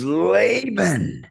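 high, slow and gushing, imitating a beaming older man; delighted greeting. Imitating the coach greeting him on the phone, delighted and slow. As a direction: retell his thrilled hello, drawn out and bright. Steve answering the call, delighted.

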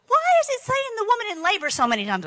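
high, quick mock bewilderment; puzzled question. Preacher recalls questioning the woman in labor verses, puzzled. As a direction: ask it fast and high, perplexed.

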